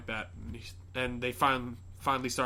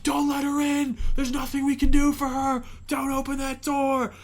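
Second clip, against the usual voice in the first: higher, louder insistent voice; urgent warning; 13 semitones higher, 7 dB louder than usual. Retold or imitated. Man at the window warning the girl, urgent. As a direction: warn her firmly not to open. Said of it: "He's like".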